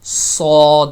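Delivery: thick, full صاد with whistle; صاد voiced full and thick; heavy saad. Shown as a demonstration. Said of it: "موٹا کر کے پڑھا جائے گا".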